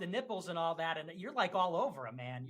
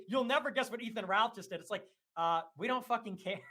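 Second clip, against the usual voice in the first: normal talking voice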